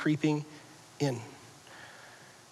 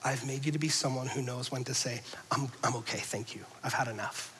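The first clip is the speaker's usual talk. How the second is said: much softer, lower and calm; gentle inner voice; 5 semitones lower, 9 dB quieter, faster than usual. Acted. God's voice guiding the listener, soft and calm. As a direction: speak it like a quiet conscience. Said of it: "external commanded voice that says".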